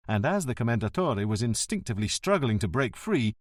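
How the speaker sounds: plain reading voice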